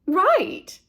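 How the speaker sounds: high, loud agreeing mother; eager agreement. Acted. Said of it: "Missus Buckmeister agreed".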